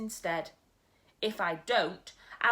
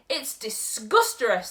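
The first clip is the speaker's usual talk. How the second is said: gurgled; 2 semitones higher, 8 dB louder than usual. Acted. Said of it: "the B F G gurgled".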